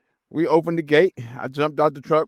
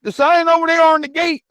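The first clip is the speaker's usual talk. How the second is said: high, loud mock guard; imitating snapping guard; 6 dB louder than usual. Narrator mimics the guard pointing at the gate sign, loud and high. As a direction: bark it like the pushy guard.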